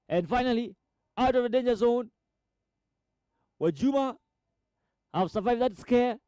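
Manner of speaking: high, urgent goalmouth commentary; football commentator caught up in the attack; excited play-by-play. Real, not acted. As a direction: call the chance with pitch held high. Cross, shot and save near goal.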